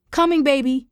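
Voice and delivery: put-on high, light call to a child. A narrator's reading. Tara answers her little cousin, high calling voice. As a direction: call through a door, pitch lifted. Aunt calls through the door.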